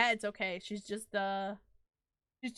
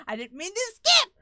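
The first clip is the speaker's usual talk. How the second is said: loud, pitched-up cry of dismay; frustrated outburst; 5 semitones higher, 13 dB louder than usual. Real, not acted. Streamer to chat after skipping a line, loud dismay. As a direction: blurt it as the cutscene jumps ahead. Accidentally skips game dialogue.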